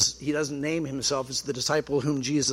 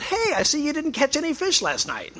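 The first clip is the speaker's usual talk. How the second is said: high-pitched mock call across the water; mock yell out; 11 semitones higher than usual. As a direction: yell it teasingly in a much higher voice. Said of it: "you don't have to yell out".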